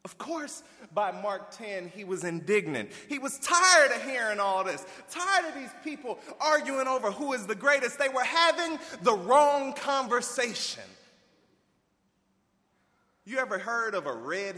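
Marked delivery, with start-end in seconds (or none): 3.1-10.8 louder and higher, building heat; impassioned preaching; rising preaching. Real, not acted. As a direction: let the frustration swell.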